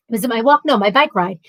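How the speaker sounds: usual speaking voice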